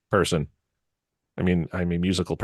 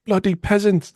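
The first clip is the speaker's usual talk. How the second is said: much higher haughty British "Bloody peasants"; mock British accent; 10 semitones higher, 3 dB louder, slower than usual. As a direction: sneer it like a posh Brit. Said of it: "in the safety of America".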